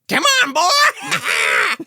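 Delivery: drawn-out, high put-on holler; mock menacing shout. Bully shoving him against the wall, drawn-out mock shout. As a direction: holler it slowly at high pitch. Acting out an attack on someone.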